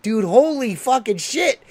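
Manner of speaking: high, loud, drawn-out astonishment; retold amazement. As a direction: relive being blown away, stretching the words. Retelling amazed reaction to the impression.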